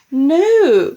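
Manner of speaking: loud, high alarmed cry. A narrator's reading. Daughter stopping grandma taking the cup, alarmed cry. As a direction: cry out to stop her. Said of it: "cried Missus Cranky".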